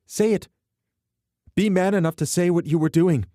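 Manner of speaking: very high, loud shouted challenge; shrill furious dare. A narrator's reading. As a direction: shame him into answering. Alana shames him.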